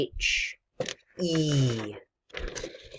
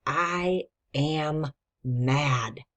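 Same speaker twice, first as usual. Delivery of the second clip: each word drawn out, very slow; read slowly; much slower than usual. Shown as a demonstration. Teacher dictating the sentence to children, very slow. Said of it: "go back and read it slowly".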